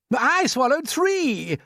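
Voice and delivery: high, loud and slow one-upping cry. Acted. Centipede outboasting the earthworm, crying out. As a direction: top everyone's boast, loudly. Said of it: "the centipede cried".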